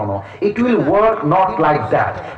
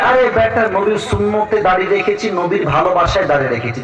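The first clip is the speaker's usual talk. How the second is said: ordinary delivery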